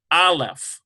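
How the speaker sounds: AH-leph, accent up front; first-syllable stress. Shown as a demonstration. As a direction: stress the A of Aleph, let leph fall away. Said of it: "accent on this first syllable".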